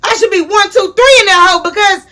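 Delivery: high, fast, heated yell with a laugh in it; half-laughing angry rant; mad yelling. Retold or imitated. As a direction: shout at the boyfriend, fired up. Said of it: "'cause I'm mad".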